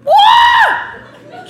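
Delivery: a man losing it over free stuff; excited shout. Acted. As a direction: blurt it out in delighted disbelief. Man inside finds gift basket.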